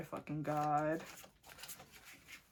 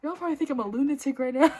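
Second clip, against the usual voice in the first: loud, high, fast talk through laughter; laughing; 6 semitones higher, 10 dB louder, faster than usual. Real, not acted. Reader laughs at own outburst to viewers, loud laughing talk. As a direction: rush it out loudly while laughing.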